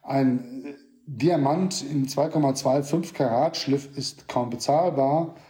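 ordinary talk, nothing marked

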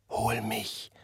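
John whispers to be fetched; whispering. A narrator's reading. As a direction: beg in a whisper, barely audible. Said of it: "flüsterte John".

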